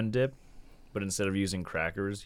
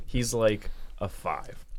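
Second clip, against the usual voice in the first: normal talking voice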